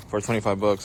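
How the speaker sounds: ordinary talk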